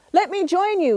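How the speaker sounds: high eager coyote plea; trickster tale voices. Acted. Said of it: "he said".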